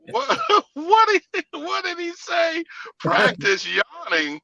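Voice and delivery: incredulous, slow, laughing and high; slow high laughing what-did-he-say; laughing disbelief. Retold or imitated. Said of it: "we was like".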